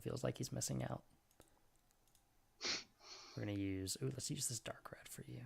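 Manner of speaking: whispered to herself while drawing; half under the breath, absorbed; quiet muttering. Real, not acted. Game master muttering to herself while sketching.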